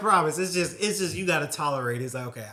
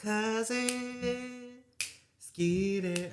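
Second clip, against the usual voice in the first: sing-song call; 11 semitones higher, much slower than usual. Retold or imitated. Imitating the show's intro call.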